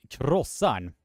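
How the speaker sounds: krossan with rolled r, short o; krossan, KROSS-an, Swedish r; Swedish pronunciation. Shown as a demonstration. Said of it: "how you are pronouncing this is".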